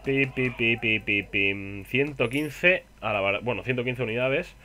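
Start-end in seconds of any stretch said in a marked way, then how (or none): 0.0-1.8 pi pi pi lento y cantarín; pitido imitado. Pretend. (onomatopeya de pitido alargada)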